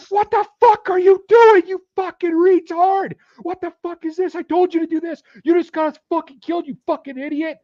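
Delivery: high, loud furious scream. Retold or imitated. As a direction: tear into a teammate at the top of your voice. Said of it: "And he's just screaming at".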